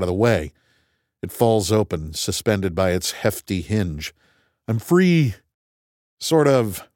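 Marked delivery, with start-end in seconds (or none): 4.7-6.9 high, bright burst of relief; cheer, then hedge; excited. Real, not acted. Hatch opens.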